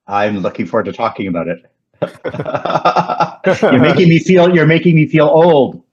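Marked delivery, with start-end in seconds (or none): 2.0-5.9 laughing while saying it, high and loud. Real, not acted. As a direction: laugh through the words, teasing yourself.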